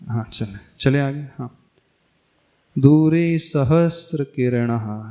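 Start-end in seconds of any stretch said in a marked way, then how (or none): 2.8-5.1 दूरे सहस्र किरणः slowly, सहस्र with sr and no t; measured recitation, सहस्र as sa-has-ra; correct sahasra. Shown as a demonstration. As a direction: articulate सहस्र clearly without a t. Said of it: "सहस्त्र नहीं पढ़ना".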